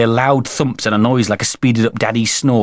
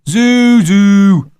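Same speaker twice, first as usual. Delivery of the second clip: high, loud chanted taunt; boy's mocking mooing shout; cried out; 11 semitones higher, 8 dB louder, much slower than usual. A narrator's reading. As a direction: bellow long rhyming hoots. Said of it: "cried Jeremy James".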